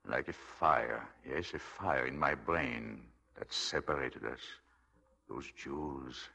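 slow, obsessed. Acted.

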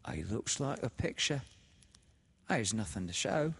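fond and sly. A narrator's reading. The sister showing the dead man to Laura, fond and sly. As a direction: croon it fondly, slyly. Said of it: "her voice sounded fond and sly".

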